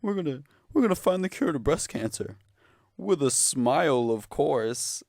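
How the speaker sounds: high, fast, loud cheery mock of the website; bubbly fake-positive pitch; mock charity voice. Mocking the breast cancer website's cheerful description, high and bright. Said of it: "It's like".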